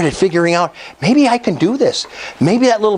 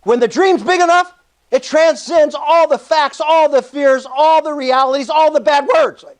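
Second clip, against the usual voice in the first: high-pitched, drawn-out preacher's build; sermon-style delivery, pitched up; 7 semitones higher than usual. Real, not acted. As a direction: preach it with rising conviction. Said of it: "I told you I was a preacher".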